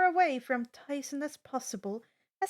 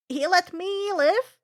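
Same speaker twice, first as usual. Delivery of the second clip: a hint of a higher, louder satyr voice; lightly voiced Grover; 7 semitones higher, 9 dB louder than usual. A narrator's reading. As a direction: agree eagerly, a bit higher. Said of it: "Grover agrees".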